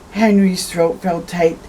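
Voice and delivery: usual speaking voice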